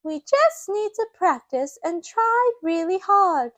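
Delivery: put-on high cheerful child voice; high child voice cheering others on; Peppa. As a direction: encourage friends like a little girl. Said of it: "Peppa says".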